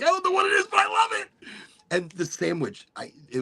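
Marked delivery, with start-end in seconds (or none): 0.0-1.3 put-on very high excited kid voice; squeaky kid voice. Remembering being a kid offered a beef eater, high squeal. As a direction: squeal it like a thrilled little kid.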